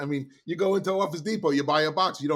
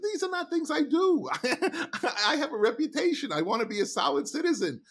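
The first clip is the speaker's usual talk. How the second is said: laughing refusal; 13 semitones higher than usual. Retold or imitated.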